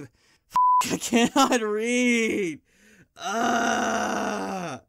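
loud, slow, exasperated yell at the script; frustrated outburst. Real, not acted. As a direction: blow up because you can't read the line. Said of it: "I'm sorry".

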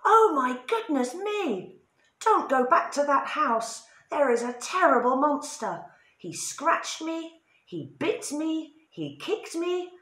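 high, loud panicky robber; frightened robber. A narrator's reading. Robber warns his friends about the monster, high panicky voice. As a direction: babble in terror. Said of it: "went back to his robber friends and said".

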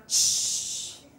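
long voiceless hiss; sustained shushing escape of air; deflating ball hiss. Shown as a demonstration. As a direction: imitate air leaking out. Imitating air leaking from punctured ball.

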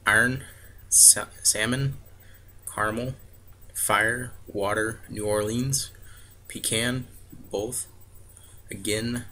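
normal talking voice

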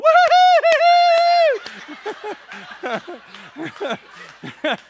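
high, loud, drawn-out whooping laugh; hammed-up hooting laugh of a delighted wife; wife's whooping laugh. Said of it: "my wife is going".

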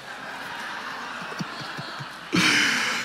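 soft high laugh at his own joke; breathy laugh. Real, not acted. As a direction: chuckle it off lightly.

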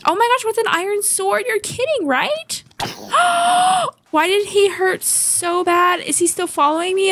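high panicked shrieks; fleeing in real panic; being chased. Real, not acted. Armed baby zombie chases her.

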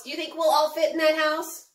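ordinary talk

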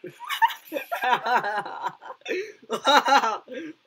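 loud, high burst of laughter; real uncontrollable cackling; helpless laughter. Real, not acted. Losing a try not to laugh challenge, helpless laughter. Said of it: "I keep on laughing".

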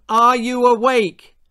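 high, loud call. A narrator's reading. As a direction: call it to rouse him. Mum calling through the house.

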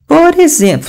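high-pitched mimicry of a teacher; imitating teacher. Mocking the English teacher's bad pronunciation, imitating. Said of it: "tô imitando".

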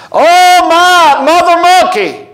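high, drawn-out mock wail; hammed-up cry for help; imitated screaming. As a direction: cry out in pretend panic, pitch held high. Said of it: "they're screaming and crying out".

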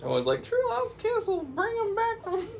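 very high laughing falsetto; high witch voice breaking into laughs; mocking laughter. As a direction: cackle at the idea of true love. Witch mocks true love, laughing.

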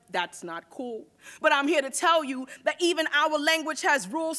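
ordinary talk

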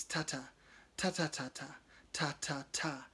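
clicked rhythm. Acted. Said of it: "his tongue clicking against the roof".

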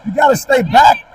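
usual speaking voice

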